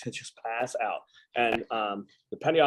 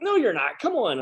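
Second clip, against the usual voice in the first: higher, teasing insistence; playful higher come-on from shipmates; mock buddies insisting; 11 semitones higher than usual. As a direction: coax a tired friend out for the night.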